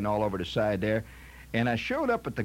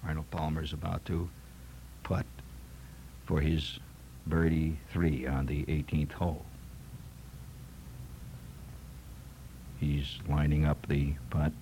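hushed golf commentator; 10 semitones lower, 6 dB quieter than usual. Retold or imitated. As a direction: murmur it like a church-quiet golf announcer. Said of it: "They talk like this".

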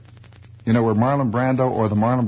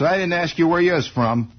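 tough guy retort; 7 semitones higher than usual. Retold or imitated. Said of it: "He says".

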